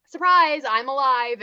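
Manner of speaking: high, loud burst; lightly acted triumphant pop-up; mock excited reveal. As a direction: announce yourself as a playful surprise. Voicing Scooby revealing he is alive.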